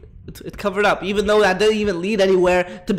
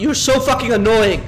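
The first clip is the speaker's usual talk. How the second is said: louder, higher exasperation; exasperated outburst; 4 semitones higher, 6 dB louder than usual. Real, not acted. Player fed up with an annoying game, exasperated outburst. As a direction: snap in loud annoyance.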